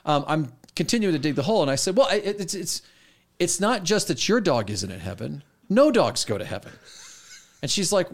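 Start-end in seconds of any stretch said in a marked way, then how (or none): 2.0-6.7 tag. Retold or imitated. Retold digging himself deeper with student.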